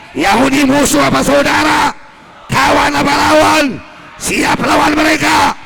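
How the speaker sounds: loud, fast, shouted sermon; fiery preaching. Acted. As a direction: whip the congregation up with every question. Preacher shouting at full force. (ceramah berapi-api dengan suara lantang)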